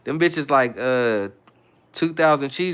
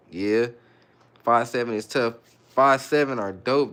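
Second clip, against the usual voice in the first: usual speaking voice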